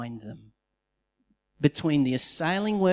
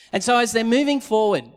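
usual speaking voice

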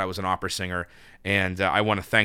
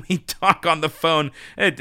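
amused laugh; 6 semitones higher, 4 dB louder than usual. Real, not acted. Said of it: "it's kind of funny".

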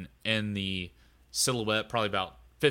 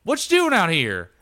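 high, loud trucker holler; Doug yelling; 9 semitones higher, 11 dB louder than usual. Acted. Doug shouting at the creature on the road, high and loud. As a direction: bellow at the creature to clear the road. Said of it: "and he's like".

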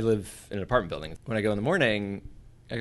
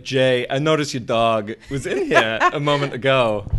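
slow, loud Walken impression, laughing; halting Walken delivery with laughs; Chris Walken impression; 4 semitones higher, 9 dB louder than usual. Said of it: "a pretty good Chris Walken".

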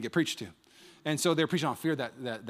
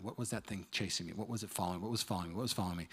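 nervous mutter; 6 semitones lower, 11 dB quieter, faster than usual. Retold or imitated. Nervously peering out window.

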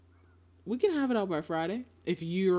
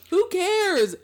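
exasperated shout; 13 semitones higher, 9 dB louder than usual. Real, not acted. Loud exasperated retort.

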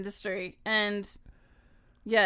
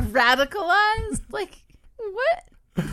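higher, slow, rising disbelief; incredulous; 9 semitones higher, 6 dB louder, much slower than usual. Real, not acted. Co-host reacting to an absurd label, incredulous. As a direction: question the word, climbing in pitch.

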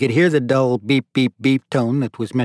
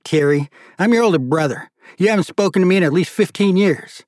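indignant plea; 7 semitones higher, 4 dB louder than usual. Acted. Marty pleads with brother.

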